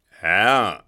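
sudden loud bellow; marshal's booming outburst; thundering. A narrator's reading. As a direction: cut him off with a thunderous shout. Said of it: "donnerte ihn Blücher da an".

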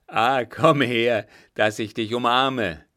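loud, jubilant taunt; exultant shout at an opponent; gloating cry. A narrator's reading. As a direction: crow it out, drawing out the first words. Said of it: "rief frohlockend".

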